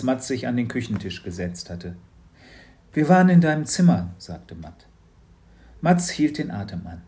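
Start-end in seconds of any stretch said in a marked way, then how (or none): 2.9-4.1 louder, quick parent; confronting the son; parent's voice. Acted. (lauter, schneller Elternteil)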